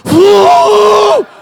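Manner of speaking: high, loud kettle-like whoop; shrill squeal of pain like a kettle; tea kettle whistle. Retold or imitated. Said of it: "sounding like a tea kettle".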